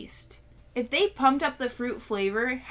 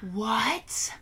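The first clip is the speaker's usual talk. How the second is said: shocked whisper. Real, not acted.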